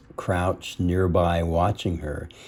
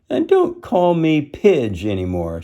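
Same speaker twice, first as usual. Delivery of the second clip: higher, louder snarl; a higher, louder snarl at Tramp; 7 semitones higher, 4 dB louder than usual. Acted. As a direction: bite the words off at him. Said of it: "she snarled".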